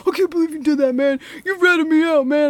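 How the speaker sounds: high, loud mock complaint; accusing student. Kyle glaring at Michael for ratting, mock outraged. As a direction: imitate a boy whining at a traitor.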